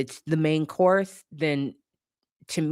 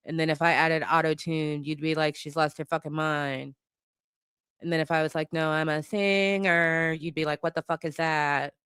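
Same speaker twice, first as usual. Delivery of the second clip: mock monotone singer; 3 semitones higher than usual. Mocking a rapper who claims to be a singer, droning monotone. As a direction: drone every word on one held note. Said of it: "That's what the fuck he sounds like".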